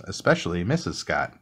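ordinary delivery, nothing marked

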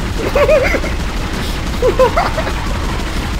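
long, high laugh after a scare; high-pitched laughing fit. Real, not acted.

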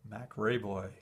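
ordinary talk

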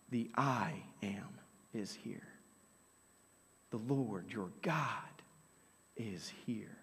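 soft, low reverent whisper; a whispered awe for God's presence; hushed whisper. Acted. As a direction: breathe it out like a holy secret.

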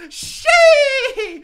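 high, loud gritty scream through a laugh; a hammed-up gritty shriek; gritty stage scream. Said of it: "Yes, I did gritty on the stage".

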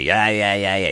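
high sarcastic yeahs; mock dismissive yeah. Heckling girlfriend brushing off the comic, mocking. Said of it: "she's yelling back at me".

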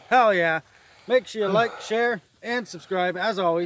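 loud vlog outro. Acted. Outro sign-off.

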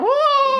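a very high mock yelp of shock; a sudden high-pitched mock cry; imitated shriek. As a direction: squeal it like the man on the flight.

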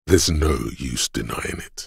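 usual speaking voice

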